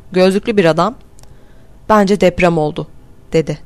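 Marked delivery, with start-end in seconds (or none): none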